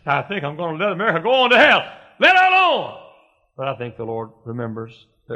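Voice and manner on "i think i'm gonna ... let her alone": quick and raised, climbing to a high shout; divine judgment, building. Acted. The Lord giving up on America, building to a shout. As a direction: rush the verdict, then bellow the last words. The Lord's judgment, voiced by preacher.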